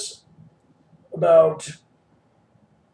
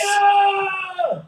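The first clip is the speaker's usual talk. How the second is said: cheering. Acted. Cheering reply.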